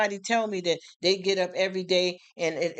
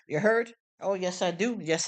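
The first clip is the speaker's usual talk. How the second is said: ordinary delivery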